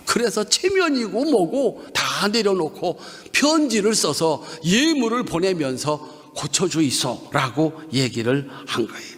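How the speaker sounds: normal talking voice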